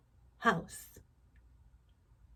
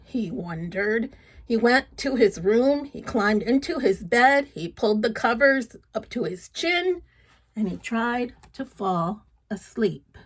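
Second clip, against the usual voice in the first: louder, lively read-aloud storytelling; story narration; 6 dB louder than usual. Acted. Reading a folk tale to children, lively narration. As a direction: tell it with energy to a child.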